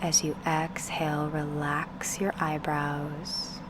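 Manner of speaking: unhurried meditation guide, with long pauses between phrases; guided meditation. Acted. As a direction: ease the listener into stillness, spacing out each instruction.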